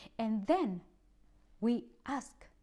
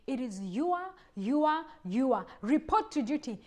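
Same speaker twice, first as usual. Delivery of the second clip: ordinary talk, nothing marked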